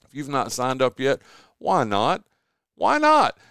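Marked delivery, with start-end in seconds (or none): 2.8-3.4 high, loud incredulous repeat; excited why not. Real, not acted. Host challenging listeners, high excited why not. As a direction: push the question up in mock disbelief.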